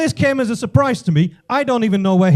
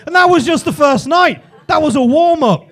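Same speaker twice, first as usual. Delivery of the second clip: high, loud boastful outburst; excited exclamation; 5 semitones higher, 7 dB louder than usual. Acted. Comedian on his first night in Benidorm, excited exclamation. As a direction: shout it as the topper to a wild tale.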